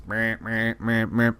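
repeated droning buzzer noise; buzzer imitation. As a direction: imitate an alarm buzzing in bursts. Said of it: "The buzzer's going off in the background".

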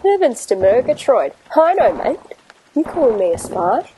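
cheery Aussie bloke; matey Australian banter; Australian accent. Acted. Australian captain Davo sketch.

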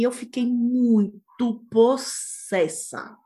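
"muito possessa" stretched out slowly, syllable by syllable; drawn-out emphasis. Real, not acted.

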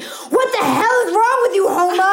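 high, loud imitated yell of the boyfriend; mock his furious outburst after the spill; angry outburst. Boyfriend yelling at his girlfriend after she spilled the table, imitated angry shout. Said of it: "actually gets so angry that he slams his fist".

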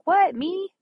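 startled high squeak of disbelief; surprised. Retold or imitated. Invited onto the prayer team, she reacts in surprise. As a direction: caught off guard at being picked. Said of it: "I'm like".

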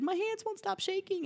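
very high, small helpless voice; soft squeaky plea, acting out panic; imitated near-tears protest; tearful puddle. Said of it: "just became like a puddle".

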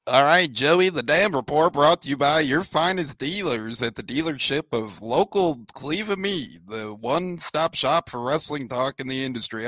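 lightly hyped ad read for the dealership; half-serious sponsor announcement. Acted. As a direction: plug the sponsor like a radio host. Co-host reads the sponsor plug.

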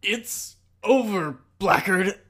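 high, loud and slow victory line; triumphant hero. Retold or imitated. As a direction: declare the win with a flourish.

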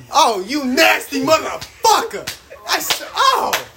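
high, slow cry of disgust; drawn-out, high-pitched revulsion; disgusted outburst. Real, not acted. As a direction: exclaim in appalled disbelief.

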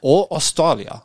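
put-on slow Austrian accent; drawn-out Austrian accent. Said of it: "Was that an Australian accent?".

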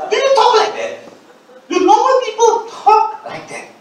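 high-pitched, exasperated questioning; incredulous. Real, not acted. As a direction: ask it in high-pitched disbelief. Mocking church jargon with rhetorical questions.

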